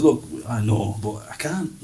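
soft, fading confession; a hint of a grudging murmur; muttered admission. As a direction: drop it low and quiet, almost to nothing.

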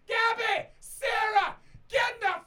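put-on drunk shouting. Drunk guy bellowing at Gabby and Sarah to get in. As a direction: yell across the street to get them in the cab.

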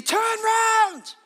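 very high, loud urgent shout; full-voiced cry of alarm, high and long. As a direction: yell it like a street preacher. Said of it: "he's shouting exactly the same thing".

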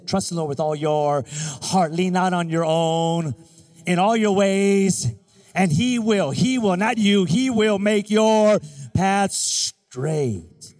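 loud, slow, drawn-out scripture; preaching scripture. Acted. Preacher driving the verse home to the congregation, loud and slow. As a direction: proclaim the verse to the crowd.

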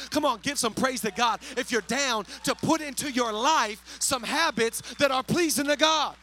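loud, driving sermon delivery; full-force preaching. Real, not acted. Preacher rallying the congregation around holy habits, full-force preaching.

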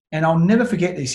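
ordinary delivery

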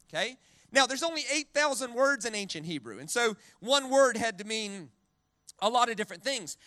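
normal talking voice

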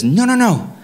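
hammed-up calm lecture to the officer; a lightly voiced Greg living his truth; Greg under arrest. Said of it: "He says".

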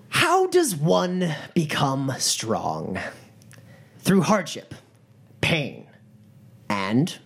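slow, drawn-out rhetorical question; gang leader orating. Acted. Gang leader addressing a shocked crowd, slow and solemn. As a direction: let each answer land after a pause. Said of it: "he turns back to the crowd".

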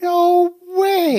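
high, drawn-out real amazement; amazed. Real, not acted. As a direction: let the astonishment lift your pitch. Host reacting to surprising story.

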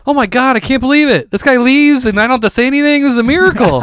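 high-pitched, thrilled relief; elated disbelief. Acted. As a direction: marvel as if a miracle just happened. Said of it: "kind of elated".

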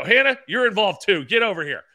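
high, loud summons; calling someone over. Retold or imitated. Self-styled guru recruiting Hannah to the boot camp, calling out.

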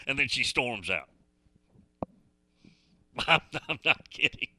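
usual speaking voice

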